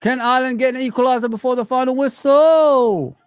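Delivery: high, loud excited question like a commentator; willing a late equalizer; excited commentary. Real, not acted.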